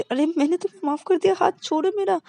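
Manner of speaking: very high, impatient give-in; exasperated. Acted. Girlfriend impatiently forgiving to free her hand.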